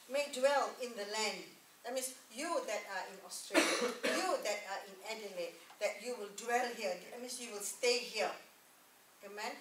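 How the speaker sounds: ordinary delivery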